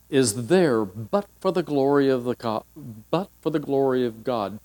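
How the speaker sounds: ordinary talk, nothing marked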